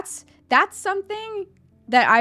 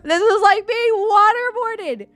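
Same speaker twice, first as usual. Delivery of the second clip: mock anguished whine; 6 semitones higher, 4 dB louder, much slower than usual. Mock anguish, torture complaint.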